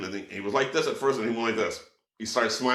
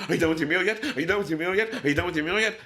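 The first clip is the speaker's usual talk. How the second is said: pushy, raised "are you done" nag; impatient guy; 7 semitones higher, much faster than usual. Retold or imitated. Imitating an impatient diner nagging the waiter about the check. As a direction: hurry him along, eyes on his plate. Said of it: "that impatient guy saying".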